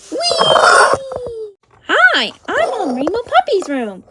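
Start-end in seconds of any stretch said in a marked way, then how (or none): 0.1-1.5 high, long whee; playful squeal. Pretend. Getting tiny to enter the dollhouse, gleeful squeal. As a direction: squeal as if whooshing down a slide.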